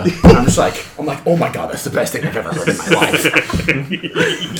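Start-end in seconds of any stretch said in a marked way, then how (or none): 1.3-4.6 laughter breaking through the words; laughing fake praise. Real, not acted. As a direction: overpraise while cracking up. Laughing at the pizza joke.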